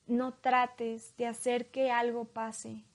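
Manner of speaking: calm hypnosis guide, long pauses. Acted. Leads a hypnosis induction. (voz lenta de hipnotizador)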